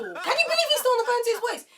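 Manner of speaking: very high, loud squawk of disbelief; incredulous outburst. Retold or imitated. Asking viewers about his endless call, incredulous outburst. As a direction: blurt it out in comic outrage.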